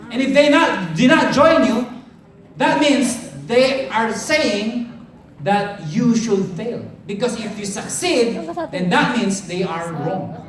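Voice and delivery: loud, high rallying preacher voice; a big motivational shout from the stage; preaching at full force. Acted. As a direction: fire up the crowd like a revival preacher. Motivational speaker rallies the audience.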